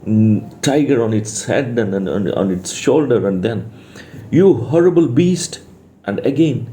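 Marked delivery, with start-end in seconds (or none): 4.3-5.6 raised, higher storybook scolding; understated angry cry; mild angry scolding. Acted. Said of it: "a voice screamed angrily".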